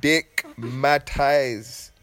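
slow, savoured repeat. Real, not acted. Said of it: "To have you dickmatized".

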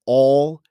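"all" hit hard and loud; the cop stressing "all" loudly. Retold or imitated. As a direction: punch out "all" as if in capitals. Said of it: "capital A, L, L".

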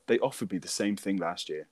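ordinary delivery

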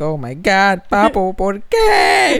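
long drawn-out, rising wail; hammed-up lament; mock despair. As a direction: plead in comic anguish, stretching the last word. Acts out own dismayed cry.

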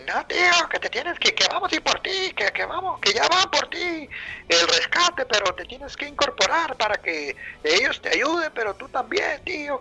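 voz aguda insistente del amigo; amigo español insistiendo. Retold or imitated. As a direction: imita al amigo que le exige moverse. Amigo insiste que se incorpore.

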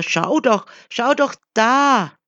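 rising, high insistent shout; crowd shouting. A narrator's reading. The crowd keeps shouting at the wanderer, insistent call. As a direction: keep yelling at him. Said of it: "diese Menschen schrien weiter".

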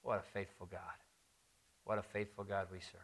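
ordinary talk, nothing marked

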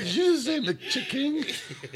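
high, slow squeak of disbelief; incredulous quote. Retold or imitated. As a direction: question it slowly in a high, baffled voice. Retells incredulous reaction to the order.

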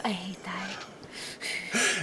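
hushed, embarrassed whisper under the breath. Real, not acted. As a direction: breathe it out so nobody hears. Embarrassed after a slip.

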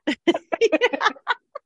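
high, rippling laughter. Real, not acted. Sharing the absurdity of the tire dispute, laughing. Laughs after acting out request.

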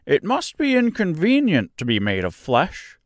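high Scarecrow voice, musing; thoughtful. A narrator's reading. Scarecrow musing to Dorothy, thoughtful and high. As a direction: ponder it aloud, puzzled by flesh. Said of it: "said the Scarecrow thoughtfully".

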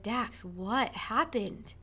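calm question. Retold or imitated. Mother asking her son what happened, slow calm question. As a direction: ask gently and slowly. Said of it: "I said".

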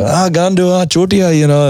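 high, sing-song teasing "Ah, gandu"; mate greeting the host with a friendly insult; mock Indian greeting. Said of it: "And he'd be like".

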